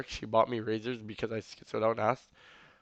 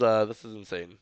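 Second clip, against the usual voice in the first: ordinary delivery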